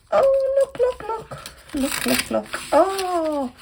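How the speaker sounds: high, quick excited repeats; thrilled cooing over a find; excited look look. Real, not acted. Showing Zoe's gifts to viewers, excited.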